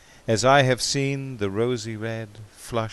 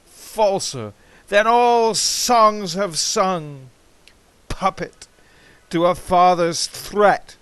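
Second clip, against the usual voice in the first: impassioned bitter outcry; 7 semitones higher, 8 dB louder than usual. Acted. Bitter outburst at faithless Amy.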